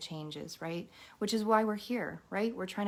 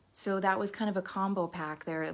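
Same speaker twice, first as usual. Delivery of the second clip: ordinary talk, nothing marked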